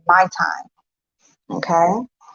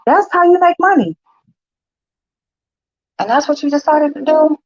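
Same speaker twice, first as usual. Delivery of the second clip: high, sarcastic disbelief; mock her reasoning, pitched way up; scoffing at daughter's choice, high and incredulous; 9 semitones higher than usual. Real, not acted. Mother mocking daughter's car sale.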